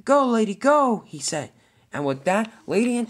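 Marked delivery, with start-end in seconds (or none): 0.0-1.0 loud, high urging call; urgent shout. Acted. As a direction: push her away to safety with a shout. Diesel ten urging Lady to flee.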